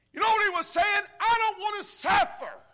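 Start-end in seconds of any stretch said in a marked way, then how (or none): 1.2-2.6 desperate thief. Acted. Preacher voicing thief's plea.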